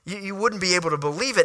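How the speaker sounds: ordinary talk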